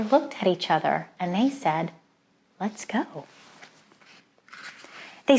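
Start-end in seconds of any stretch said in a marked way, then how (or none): 2.6-3.2 hushed eager. A narrator's reading. Shepherds decide to go.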